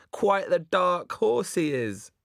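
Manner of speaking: high, slow and grandly theatrical; mock theatrical. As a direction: lay on the stage pomp. Lloyd mocks in Shakespearean speech.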